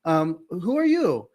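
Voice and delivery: high, put-on coy scammer; Jennifer. Scammer posing as a rich girl, imitated coy voice. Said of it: "I'm Jennifer".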